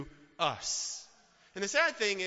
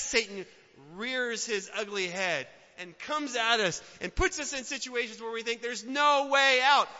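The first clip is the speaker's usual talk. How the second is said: ordinary talk, nothing marked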